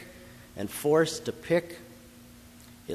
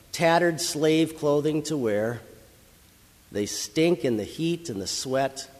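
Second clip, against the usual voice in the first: ordinary delivery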